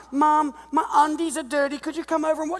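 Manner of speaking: high, lightly whining plea; mock whiny son. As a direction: whimper to mom like a man child. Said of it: "They don't ring their mom".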